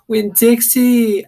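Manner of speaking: high, loud, slow scream of the name. Acted. Crying girl screaming for her lost dog in the rain. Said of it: "I screamed".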